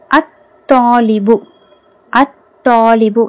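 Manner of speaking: slow "அத்தாலிபு", no L after the A, doubled T; silent lam. Shown as a demonstration. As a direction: model "அத்தாலிபு" slowly, merging the article into a held T. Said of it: "லாம் உச்சரிக்கப்படலை". ("அத்தாலிபு" லாம் இல்லாமல், த் அழுத்தி மெதுவாக)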